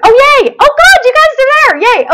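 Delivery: high, loud, quick burst of delight; relieved excitement. Real, not acted. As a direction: cheer at finding everyone still there. Finds her viewers after losing them.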